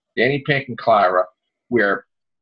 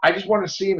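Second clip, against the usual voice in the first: appalled outrage; 9 semitones higher than usual. Real, not acted. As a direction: spit it out in disbelief. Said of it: "I'm just, like, appalled".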